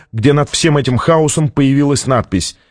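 ordinary delivery

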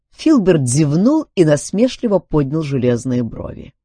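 usual speaking voice

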